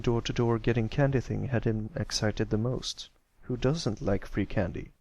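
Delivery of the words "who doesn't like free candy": ordinary delivery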